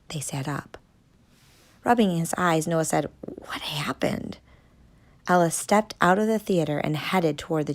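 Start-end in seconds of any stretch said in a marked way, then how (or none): 3.2-4.4 slow, dazed whisper. Acted. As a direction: barely breathe the question, stunned. Dazed after blinding flash.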